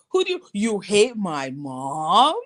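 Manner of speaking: put-on slow, accusing kid question; a touch of the little boy; imitating the boy. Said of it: "retelling the boy again".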